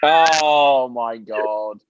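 drawn-out disbelief. Real, not acted. Reacting to candle story.

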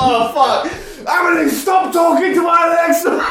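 high mock shout through the house; exasperated sibling yell. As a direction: mimic an annoyed kid yelling at a sister. Imitated sibling yelling from other room.

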